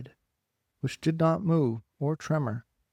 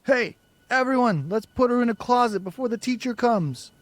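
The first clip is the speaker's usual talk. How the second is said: loud, high call to the group; rally everyone with a call; 10 semitones higher, 4 dB louder than usual. A narrator's reading. Boy calling the children to lock Margot away, loud.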